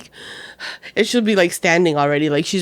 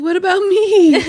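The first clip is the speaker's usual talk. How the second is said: high, loud mock wail; alarmed child; 11 semitones higher, 8 dB louder than usual. Daughter asks mom about the birth, mock alarm. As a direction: imitate a daughter afraid of being left out. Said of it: "scared of me".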